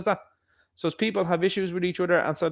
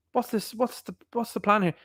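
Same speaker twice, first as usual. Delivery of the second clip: high, softer puzzled questioning; mock bafflement; 6 semitones higher, 4 dB quieter than usual. Man asking the woman sitting in the car, mock baffled. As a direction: act out a baffled date.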